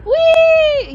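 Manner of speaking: high, loud, playful whee; gleeful squeal. Real, not acted. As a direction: squeal with delight. Gleeful cry while exploring.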